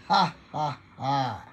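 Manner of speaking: declared ha-ha-ha; laughing at illness. Shown as a demonstration. Preacher laughing at cancer by faith, deliberate laugh. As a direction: laugh on purpose at the disease. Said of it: "Tawanan ninyo".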